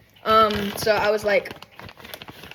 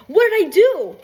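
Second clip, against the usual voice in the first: high, loud protest of innocence; loud mock protest; 9 semitones higher, 7 dB louder than usual. Dreamer protesting to teasing partner, high and loud.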